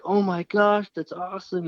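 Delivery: high, loud opening exclamation; thrilled, pitched well up; retold overjoyed outburst. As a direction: imitate a buddy bursting with happiness. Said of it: "He's so happy".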